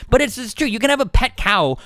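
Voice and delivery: ordinary talk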